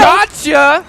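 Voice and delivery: sudden loud high exclaim; excited shout. Real, not acted. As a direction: yell it out in the moment. Caster exclaiming at a play.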